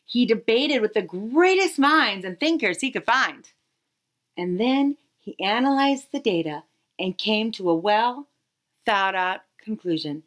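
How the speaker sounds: usual speaking voice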